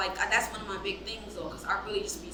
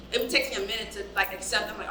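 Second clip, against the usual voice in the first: ordinary talk, nothing marked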